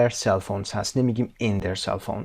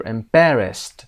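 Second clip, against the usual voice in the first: EMBARRASSED slowly, BAR punched louder; stress on BAR; 5 dB louder, much slower than usual. Shown as a demonstration. Teacher shows same pattern, stress on BAR in EMBARRASSED. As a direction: make BAR the strong syllable in EMBARRASSED. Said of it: "هم همینطور".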